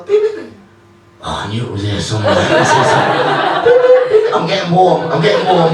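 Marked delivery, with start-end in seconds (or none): 0.0-0.5 phone beep imitation. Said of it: "he'll go". 3.6-4.3 very high, loud electronic beeps; phone beep imitation. Beeps repeated.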